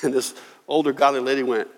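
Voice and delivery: ordinary talk, nothing marked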